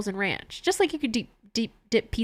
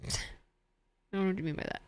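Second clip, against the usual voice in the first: mock-innocent through a laugh, much softer; 2 semitones lower, 9 dB quieter than usual. Streamer dodging chat's hospital question, laughing softly. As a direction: play dumb while chuckling quietly.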